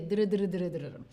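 "dr" repeated with the tongue tip trilling behind the teeth; dr drill. Shown as a demonstration. Teacher drills the D-to-R trick, repeated dr trills. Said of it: "donde está la D".

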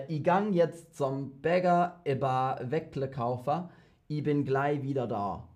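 lightly put-on Swabian German, not her own; Schwäbisch spoken by a non-native, a bit unsure; Swabian dialect. Shown as a demonstration. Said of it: "Here it is in Schwäbisch".

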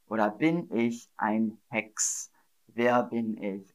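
normal talking voice

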